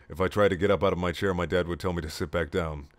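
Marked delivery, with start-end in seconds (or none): none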